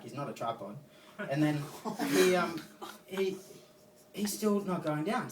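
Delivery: usual speaking voice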